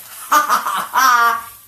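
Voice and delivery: loud high laugh; burst of laughter. Real, not acted. Caught out about Scott.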